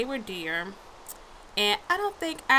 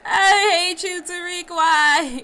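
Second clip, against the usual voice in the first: high, loud laughing wail; laugh-cry in mock despair; 8 semitones higher, 10 dB louder, slower than usual. Real, not acted.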